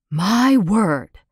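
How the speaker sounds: startled, wide-eyed burst; lightly voiced doctor, amazed at his garage; astonished exclamation. A narrator's reading. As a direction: gasp it out in amazement. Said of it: "he exclaimed".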